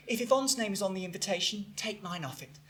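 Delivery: low, very soft refusal; quietly offended. Acted. Mother refusing to share the invitation, hushed and offended. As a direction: let it fade away, wounded.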